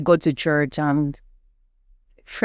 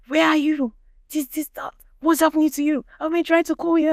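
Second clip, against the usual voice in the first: much higher, frantic mock shout; mom's shouting; 13 semitones higher than usual. Mother on the phone demanding answers, mock frantic shout. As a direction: play the panicked mother. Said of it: "my mom was shouting on somebody's phone".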